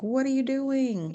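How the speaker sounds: high startled mock alarm; alarmed question. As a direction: ask sharply, caught off guard. Mother finds son in trance.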